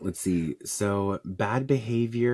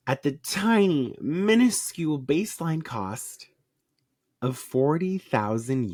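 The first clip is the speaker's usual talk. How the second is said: higher, slowed mock understatement; sarcastic; 6 semitones higher than usual. Real, not acted. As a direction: drag out tiny and minuscule sarcastically. Sarcastic about a huge price.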